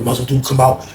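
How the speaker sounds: ordinary delivery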